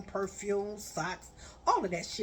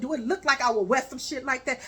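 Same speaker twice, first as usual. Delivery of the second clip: louder and faster, scolding; angry rant; 2 semitones higher, 6 dB louder, faster than usual. Retold or imitated. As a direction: tear into him, raising your voice. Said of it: "I said".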